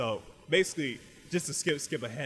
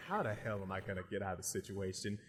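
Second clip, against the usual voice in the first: lower, softer muttered worry; inner thought; 5 semitones lower, 7 dB quieter than usual. Acted. Legoshi thinking in his head, low and quiet. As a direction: think it aloud under your breath. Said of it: "just thinking in his head".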